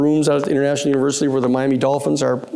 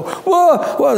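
much higher, louder startled yelp; startled whoa; 12 semitones higher, 3 dB louder than usual. Retold or imitated. Panicked clerks startled by lawyers walking in, high whoa. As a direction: jump back in alarm at the intruders.

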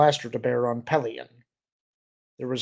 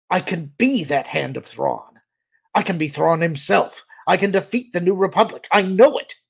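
loud plea climbing higher and louder; desperate pleading; 6 semitones higher, 9 dB louder than usual. A narrator's reading. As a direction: build from insistence to a frantic cry. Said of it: "his voice almost pleading".

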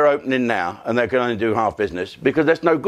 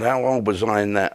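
ordinary delivery

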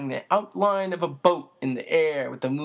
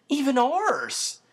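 higher, slow, delighted cry; cried in delight; 11 semitones higher, slower than usual. A narrator's reading. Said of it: "Chris cried in delight".

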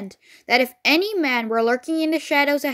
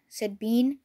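ordinary delivery, nothing marked

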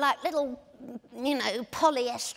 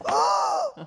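mock starstruck intake of breath; high, loud gasp at seeing a celebrity; awestruck gasp; 12 semitones higher, 5 dB louder than usual. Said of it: "and people just go".

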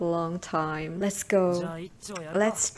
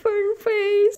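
mock squeal; 12 semitones higher, 5 dB louder than usual. Real, not acted. Mocking Fern's funny expression.

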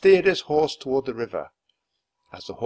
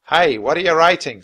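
soldier calling out; 6 semitones higher, 10 dB louder than usual. A narrator's reading. Soldier calls to Jack.

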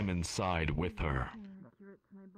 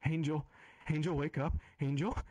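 worried mother; 9 semitones higher, faster than usual. Acted. Mother waking fainted daughter.

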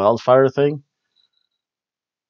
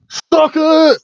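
much higher, loud taunting yell; mock yell; 7 dB louder than usual. Kevin Owens taunting Seth, a loud high yell. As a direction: shout it like a taunt. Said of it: "tells Seth to".

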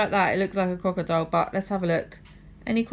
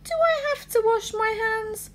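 cartoon princess; 11 semitones higher than usual. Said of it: "princess asks again".

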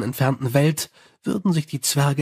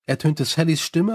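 usual speaking voice

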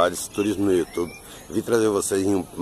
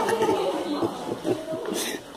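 high unvoiced laugh; 8 dB quieter than usual. Real, not acted. Laughing near the witch.

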